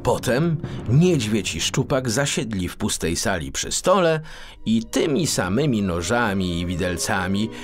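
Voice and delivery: ordinary delivery, nothing marked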